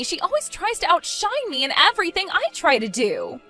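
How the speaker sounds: high-pitched petulant whine; whiny complaint. Acted. Bride runs down Fatima to mommy, whiny complaint.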